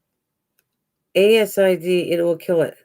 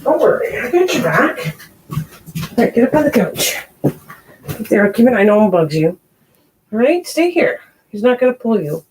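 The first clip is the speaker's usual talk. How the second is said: reassuring a pet; 2 semitones higher than usual. Real, not acted. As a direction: soothe the scared cat. Reassuring the frightened cat.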